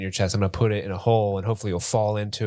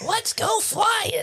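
very high, slow squeaky toy voice; teddy bear Leonard; much slower than usual. Pretend. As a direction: squeal it like an eager stuffed bear. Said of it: "That's Leonard".

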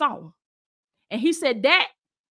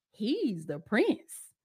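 slow reply; 2 semitones lower, 7 dB quieter, much slower than usual. Retold or imitated. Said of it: "she said".

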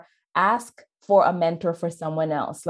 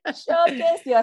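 playful repeat; 11 semitones higher, 3 dB louder than usual. Retold or imitated. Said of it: "you said".

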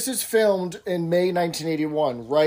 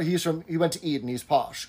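ordinary talk, nothing marked

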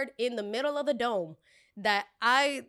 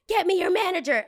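high, loud manager demand; entitled customer; 10 semitones higher, 9 dB louder than usual. Retold or imitated.

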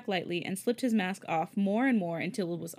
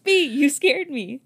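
louder, slow startled gasp; 5 semitones higher, 8 dB louder, slower than usual. Acted. Changbin startled by Felix at the window, gasping. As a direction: catch your breath from a fright. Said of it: "Changbin gasped".

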